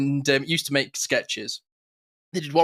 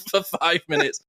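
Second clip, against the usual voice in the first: ordinary talk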